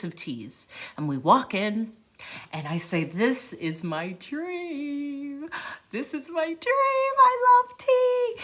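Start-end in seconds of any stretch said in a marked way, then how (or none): 3.0-8.4 drawn-out dreamy wonder climbing to high squeal; enraptured delight. Retold or imitated. Tea lover walks into the tea shop, overjoyed. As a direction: swoon with rising joy.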